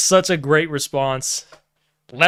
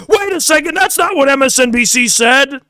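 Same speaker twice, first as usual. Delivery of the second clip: high, disbelieving mock protest; incredulous Lucas Gage; 9 semitones higher than usual. Podcaster mimicking a guest balking at a claim, high mock disbelief. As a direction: act shocked and pitch it way up. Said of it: "even Lucas Gage is like".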